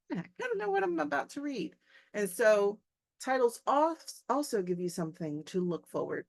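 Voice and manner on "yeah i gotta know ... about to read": high, excited voice of an eager reader. Retold or imitated. Teacher voicing a student eager to read, high and excited. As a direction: sound hooked on the story. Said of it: "You're like".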